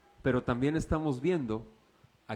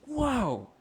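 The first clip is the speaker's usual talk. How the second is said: high, loud, rapturous pulpit testimony; preacher reliving a prayer during worship; ecstatic preaching; 8 semitones higher, 3 dB louder than usual. Acted. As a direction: carry the congregation with joyful fervor. Rapturous retelling of hymn prayer.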